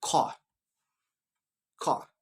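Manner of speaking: British "car" with a silent R; British accent, "car" as an open kah. Shown as a demonstration. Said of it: "In British".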